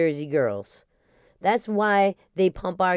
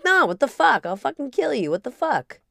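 mock outrage; 8 semitones higher, 3 dB louder than usual. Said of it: "I'm like".

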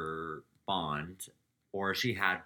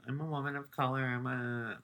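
higher-pitched imitation of a plea; mock pleading; 4 semitones higher, faster than usual. Imitating a housewife always pleading her case, mock pleading. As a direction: plead it as a lightly mocking impression. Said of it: "she's always pleading".

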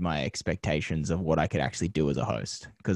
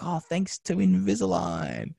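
higher, slow, mock-presenter voice; on-air plug; 10 semitones higher, slower than usual. Host plugging a brand to camera live on TV, mock-presenter voice. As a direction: turn to camera and hammily sell it. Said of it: "I was like".

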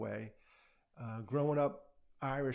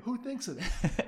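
slow, higher mock disbelief; retelling friends amazed at the CB bike; incredulous; 9 semitones higher, 3 dB louder, slower than usual. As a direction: marvel at it, slowly and higher.

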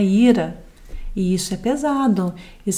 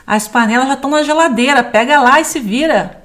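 loud, higher, fast dismissive retort; rude wife; 4 semitones higher, 4 dB louder than usual. Acted. Wife snapping back at demanding husband, loud and fast. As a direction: snap back at him, brushing him off. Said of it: "olha como ela responde".

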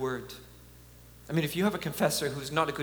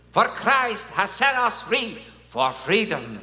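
put-on Polish accent, higher, slow and louder; John Paul II accent; 7 semitones higher, 8 dB louder, slower than usual. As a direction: proclaim "For Christ has set us free for freedom" slowly in a Polish accent with "set" stressed. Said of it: "how can I not use his accent?".

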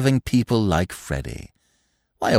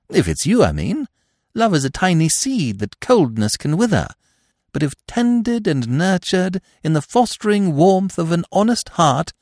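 high, breezy upper-class twit; chatty, airy young gentleman; silly young toff; 10 semitones higher than usual. A narrator's reading. Freddy's sentimental speech.

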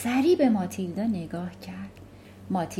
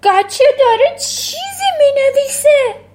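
much higher and louder shriek; screaming; 12 dB louder than usual. Acted. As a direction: scream it in disbelief. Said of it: "نایجل جیغ کشید".

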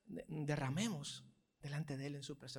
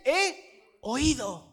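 slow, higher, louder declaration; proclaiming God's words; 7 semitones higher, 11 dB louder, much slower than usual. Acted. God answering Hezekiah, slow loud proclamation. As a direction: announce it with full force.

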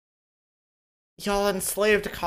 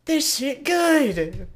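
high, very slow "this shit good"; stretched-out blissful food moan; savoring food; 9 semitones higher, 5 dB louder, much slower than usual. Pretend.

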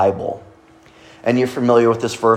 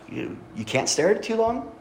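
"You, you can't stare at it too long," pitched up and soft, a doubting question; 9 semitones higher, 7 dB quieter than usual. Shown as a demonstration. Pastor demonstrating the question mark reading, doubting. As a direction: lift it high and hush it like you doubt it. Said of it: "right, with a question mark?".

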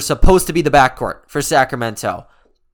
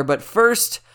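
ordinary talk, nothing marked

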